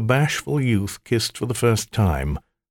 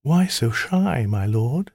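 plain reading voice

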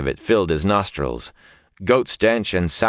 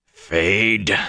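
rough. A narrator's reading. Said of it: "Perrin said roughly".

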